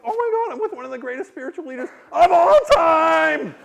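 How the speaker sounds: high, mounting shout of disbelief; hammed-up horror at full volume; horrified outburst. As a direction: build to an appalled yell. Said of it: "But I was horrified".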